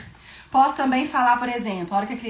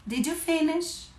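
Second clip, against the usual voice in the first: put-on asking a child; 6 semitones higher, slower than usual. Shown as a demonstration.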